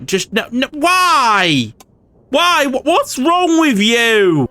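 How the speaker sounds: high pitched, drawn-out "why? why?" at the screen; slow, pained cry of disbelief; despairing disbelief. Real, not acted. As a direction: player watching his campaign fall apart, high despairing wail. Campaign collapsing, player despairs.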